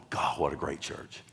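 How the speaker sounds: ordinary talk, nothing marked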